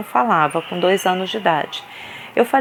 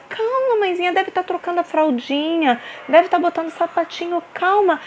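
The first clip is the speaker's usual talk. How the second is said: much higher, sugary condescension; mock-sweet patronising carer; mocking sweet staff voice; 8 semitones higher than usual. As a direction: imitate the worker brushing her off. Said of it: "E ela".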